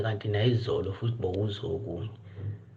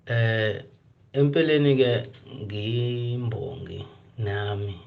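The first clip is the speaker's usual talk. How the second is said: ordinary talk, nothing marked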